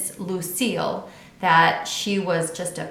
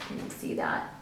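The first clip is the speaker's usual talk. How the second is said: whispered aside; 10 dB quieter than usual. Real, not acted. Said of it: "aside whisper to listeners".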